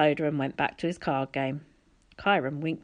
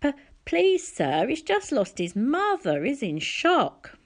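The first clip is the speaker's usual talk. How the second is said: put-on high, stuttering plea from a scared boy; nervous stammer; 8 semitones higher, slower than usual. A narrator's reading. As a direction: beg nervously, tripping on the first word. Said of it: "Grover said".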